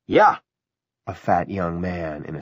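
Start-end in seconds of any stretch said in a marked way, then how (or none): 0.1-0.4 sharp loud agreement; loud high yeah. Acted. Young man agrees loudly.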